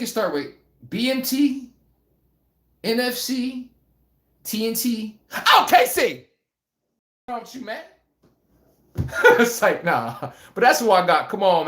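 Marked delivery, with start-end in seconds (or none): 5.5-6.3 excited call-out. Real, not acted. Calling out the winning team.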